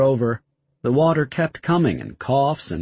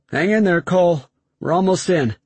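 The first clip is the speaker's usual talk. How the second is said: calling out; 6 semitones higher, 4 dB louder than usual. Acted. Tech calling from behind blast shield.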